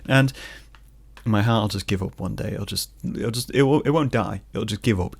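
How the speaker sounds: usual speaking voice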